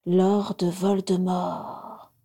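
very slow, deliberate naming; slow reading aloud. Acted. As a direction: spell it out slowly, in awe. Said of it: "lut-elle à haute voix".

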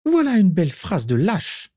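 high contemptuous woman's voice; scornful witch. Acted. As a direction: sneer it like a mad witch. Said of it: "commenta Bellatrix".

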